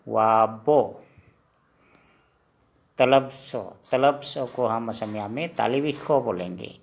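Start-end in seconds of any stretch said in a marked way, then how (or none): 0.1-0.8 वाबो repeated clearly, a touch louder; Assamese letter name. Shown as a demonstration. Teacher answers his own quiz question, modelling the sound. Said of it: "व को हम असमिया में क्या बोलेंगे?".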